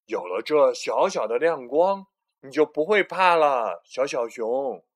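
loud reassuring bear dad; Big Bear voice. A narrator's reading. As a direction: comfort the little one. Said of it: "说：".

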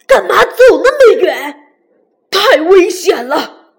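loud, angry scolding shout. Acted. Tyrannosaurus scolds the baby for going far, angry shout. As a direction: bellow at the child who wandered off. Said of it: "霸王龙生气地大声叫着".

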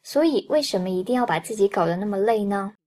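normal talking voice

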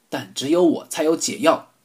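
ordinary delivery

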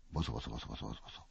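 low, soft, quick mumble; low soft mumbling noises; murmuring sound. A narrator's reading. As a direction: murmur it under your breath. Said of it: "喋ってる声がする".

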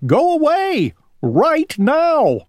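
high, piercing shout. Acted. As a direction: yell him out the door. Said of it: "shouted Owl".

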